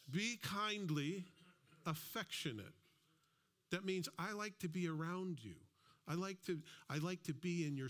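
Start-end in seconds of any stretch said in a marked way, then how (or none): 0.1-2.7 "kindly" lifted higher and drawn out, slow; stress on kindly. Shown as a demonstration. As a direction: lean on "kindly" slowly, then "affectionate" falls. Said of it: "To be kindly affectionate".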